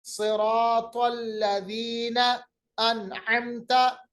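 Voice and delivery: slow, elongated Quran recitation; tajweed recitation. Shown as a demonstration. Teacher reciting for students to repeat, slow tajweed. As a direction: recite carefully, holding the long vowels.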